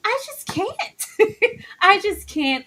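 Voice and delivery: high, drawn-out exclamation breaking into laughter; can't cope, laughing through it; slow high wail, then a laugh; overcome with amusement. Real, not acted. Overcome with laughter, exasperated reaction.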